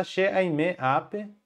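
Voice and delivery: "She aime ape" said slowly and clearly, word by word; slow model answer. Shown as a demonstration. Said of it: "A resposta". (resposta dita devagar, palavra por palavra)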